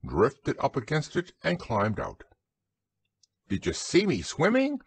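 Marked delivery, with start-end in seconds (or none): 3.5-4.8 high, eager little voice; excited squeak. A narrator's reading. Roo asks while climbing out, squeaking excitedly. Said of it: "squeaked Roo excitedly".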